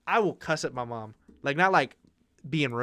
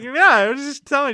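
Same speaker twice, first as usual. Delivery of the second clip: much higher, louder mock protest; 12 dB louder than usual. Son protesting to his mom, high and loud. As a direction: protest loudly in a high pitch.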